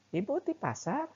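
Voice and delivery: high, cheerful small-talk question; friendly neighbour's question. Retold or imitated. (pertanyaan basa-basi tetangga, nada tinggi)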